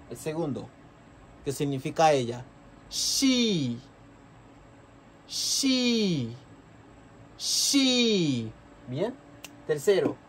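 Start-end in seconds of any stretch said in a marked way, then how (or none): 2.9-8.5 she said as "shee", higher; close English she. Shown as a demonstration. Teacher repeats she slowly for students. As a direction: stretch the "sh" in she. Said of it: "Vamos a pronunciar un poquito más de cerca".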